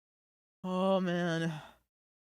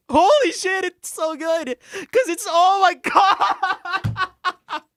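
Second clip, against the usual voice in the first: high, loud, tearful gushing; overwhelmed streamer crying over the ending; real crying; 8 dB louder than usual. Real, not acted. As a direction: voice breaking through tears of joy. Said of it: "I'm crying".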